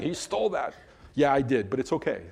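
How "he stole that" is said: mock tattletale. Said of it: "running down to the copyright office and saying".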